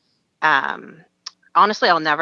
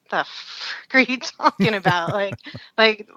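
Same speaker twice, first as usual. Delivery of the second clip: stunned disbelief; 4 semitones higher, slower than usual. Retold or imitated.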